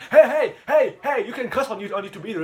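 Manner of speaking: high, loud mock police call-out; scolding interruption. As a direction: burst in and tell them off, easing as you go. Acted officer interrupting to scold.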